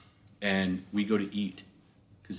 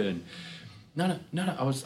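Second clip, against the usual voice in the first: ordinary delivery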